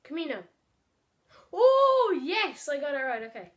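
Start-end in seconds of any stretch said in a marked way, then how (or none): 1.5-3.2 high, loud joyful cheer; excited cheer. Real, not acted. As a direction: cheer the win. Celebrating a right answer.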